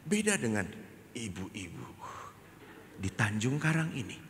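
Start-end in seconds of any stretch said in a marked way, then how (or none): none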